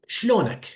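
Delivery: Iraqi dialect, "Shlonak" falling at the end; Iraqi Arabic greeting. Shown as a demonstration. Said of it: "en Irak son, si yo quiero decir".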